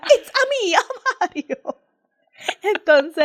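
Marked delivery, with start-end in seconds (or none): 0.0-1.8 high mock Mario greeting. Mother quoting Mario's favourite line, mock voice. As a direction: ham up the plumber catchphrase. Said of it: "Mario se presentaba y decía".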